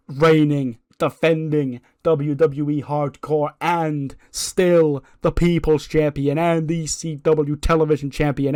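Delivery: hyped-up ring announcer. Acted. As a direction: draw out each title with mounting grandeur. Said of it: "could have been a little bit more excited".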